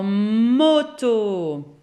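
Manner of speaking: very slow, each sound of moto drawn out. Shown as a demonstration. Teacher to pupils, drawing out moto slowly. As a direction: stretch the word moto for little children. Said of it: "on commence toujours, hein, ici par ce son-là".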